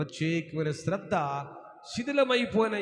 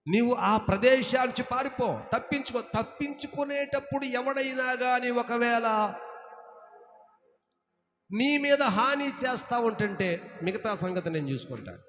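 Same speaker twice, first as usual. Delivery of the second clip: loud raised preaching, slowing on the verse; full-force preaching; 5 semitones higher, 4 dB louder than usual. Acted. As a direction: proclaim it from the pulpit. Preacher proclaims God's promise. (గట్టిగా బోధించే స్వరం)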